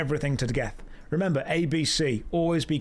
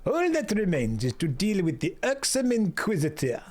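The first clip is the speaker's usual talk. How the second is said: game character in a hammed-up French accent; Alphino, rough French accent; 2 semitones higher than usual. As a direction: put on a rough, generic European-French accent. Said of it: "That's a rough French accent".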